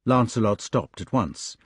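ordinary delivery, nothing marked

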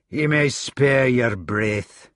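slow, dry Scottish put-down; Scottish accent. Acted. Gawain silencing Gareth, slow Scottish accent. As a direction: cut the boy off in broad Scots. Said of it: "He still kept his outland accent".

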